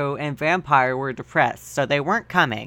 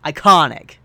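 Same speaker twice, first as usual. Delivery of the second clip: louder, punched "Iconic"; emphatic praise; 7 dB louder than usual. Acted. Friends reading fanfic, hyping a line loudly. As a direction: declare "Iconic" with relish. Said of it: "Iconic phrase".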